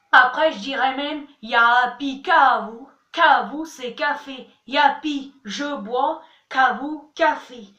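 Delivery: ordinary talk, nothing marked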